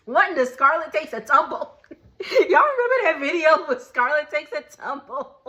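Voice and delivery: laughing through the question; giggling as she recalls the video. Real, not acted. Laughing while recalling viral video.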